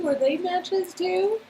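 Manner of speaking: ordinary delivery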